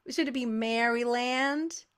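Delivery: put-on American accent on "Maryland"; attempted American accent. Shown as a demonstration. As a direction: try "Maryland" the American way, exaggeratedly. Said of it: "sorry for whatever accent that was".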